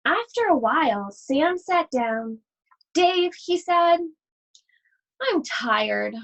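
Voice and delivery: put-on lively read-aloud voice for children; story reading. Teacher reading a picture book to her class, animated storytelling.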